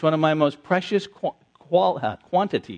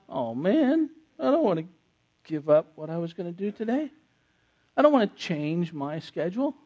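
reluctant whine; 4 dB quieter than usual. Acted. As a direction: grumble reluctantly, only lightly whiny. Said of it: "say it with that kind of tone".

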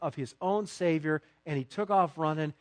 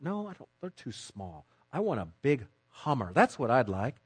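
a dreamy voice, lowered and softer, mocking a greedy believer; mock greedy wish list; 6 semitones lower, 4 dB quieter than usual. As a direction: play a believer sighing over a house on the beach. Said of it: "You say".

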